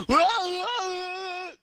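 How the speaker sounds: very high, loud cartoon wail; wacky panicked wailing. Cuts to anime guy's wacky face, mock panicked wailing. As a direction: imitate a crazy anime freak-out noise. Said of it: "making a wacky, crazy".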